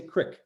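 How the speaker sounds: crick with a short vowel, rhyming with "brick"; short clipped I in crick, as regional creek; regional "crick" pronunciation. Shown as a demonstration. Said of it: "parts of the United States where you say".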